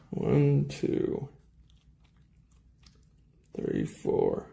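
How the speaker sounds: whispered counting; counting under breath. Real, not acted. Counting screws quietly to himself.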